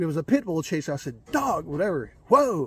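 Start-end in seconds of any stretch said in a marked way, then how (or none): 1.3-2.7 yelling at a dog. Retold or imitated. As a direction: call the dog off hard. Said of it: "I yelled at the dog".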